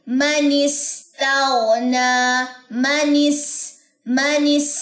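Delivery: मनिस slow with whistled s and a pause on it; pronunciation demo. Shown as a demonstration. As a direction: hiss the s in मनिस and stop there. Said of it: "कैसे?". (मनिस, सीन पर सीटी और ठहराव)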